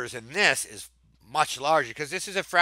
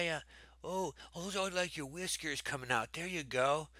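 soft hushed voice so the kitten can sleep; hushed to kitty; 11 dB quieter than usual. Real, not acted. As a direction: murmur gently, don't wake the cat. Softly talking to sleeping kitty.

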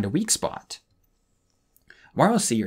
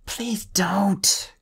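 breathless, higher whisper; whispered thought; 9 semitones higher than usual. A narrator's reading. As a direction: barely breathe the words. Clay's silent desperate thought.

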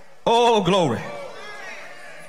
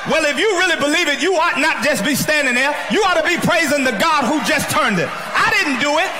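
preaching at full force; 6 semitones higher than usual. Acted. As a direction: lift them up with each line. Preacher building again.

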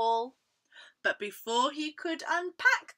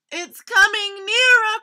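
high, loud crocodile alarm; frightened cry; 10 semitones higher, 17 dB louder than usual. A narrator's reading. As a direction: cry a warning in storybook fright. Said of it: "Crocodile cried, his teeth chattering".

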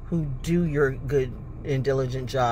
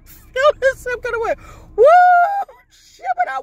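very high squealing cry; excited squeal; 8 dB louder than usual. Real, not acted.

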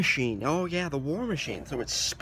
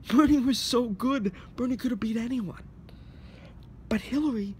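usual speaking voice

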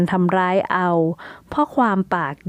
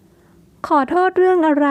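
puzzled gentle question; 8 semitones higher, much slower than usual. A narrator's reading. Tipyang asking Jara why she apologizes, gentle. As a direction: ask kindly what she means. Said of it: "ทิพยางค์ได้ถามต่อไปว่า".

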